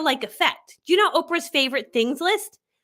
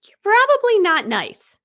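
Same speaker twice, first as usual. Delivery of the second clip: emphatic declaration; 6 semitones higher, 5 dB louder than usual. Shown as a demonstration. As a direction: stretch it out loudly and pitch it up. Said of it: "That's how I say it".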